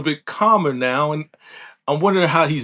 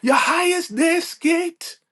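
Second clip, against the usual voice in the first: way up high, nervous and pleading; high, humble tattletale voice with slow drawn words; mock Elder Mother; slower than usual. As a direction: squeak it out like a messenger scared of blame. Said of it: "But she's like".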